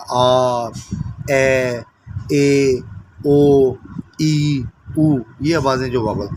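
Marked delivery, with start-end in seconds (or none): 0.1-5.2 clearly sounding each vowel apart; vowel sounds demo. Shown as a demonstration. Teacher modelling vowels for shorthand students. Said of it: "वो हम बयान कर सकते हैं".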